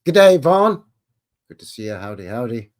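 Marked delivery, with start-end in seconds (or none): 0.0-0.8 calling out. Real, not acted. Loud shout-out greeting a viewer.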